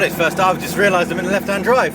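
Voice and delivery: ordinary talk, nothing marked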